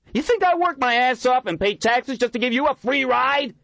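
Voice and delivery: high, loud screeching rant; young man screeching at the homeless leader. A narrator's reading. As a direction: screech it in outrage. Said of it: "he screeched".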